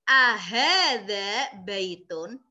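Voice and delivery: "Ahada baitun" slow, rising at the end; Arabic model reading. Shown as a demonstration. Teacher modelling a new question phrase, slow and clear. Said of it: "teacher models Arabic reading".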